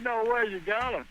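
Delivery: high, laughing "no way, you got him"; excited disbelief. Retold or imitated. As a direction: burst out with laughing amazement. Friend got the target buck.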